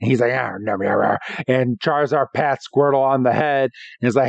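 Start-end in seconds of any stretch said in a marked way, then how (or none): 0.3-1.2 angry eating. Retold or imitated. Squirtle keeps eating while still mad, mock angry munching. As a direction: imitate grumbling through angry mouthfuls. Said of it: "who's still angry eating".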